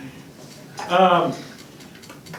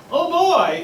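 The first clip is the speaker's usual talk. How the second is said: put-on higher, gleeful oh boy; excited; 9 semitones higher, 3 dB louder than usual. Child hearing God come, gleeful. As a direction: sound like a child thrilled. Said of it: "does not elicit".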